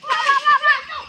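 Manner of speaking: high, loud repeated calls; real urgent yelling; urgent calling. Real, not acted.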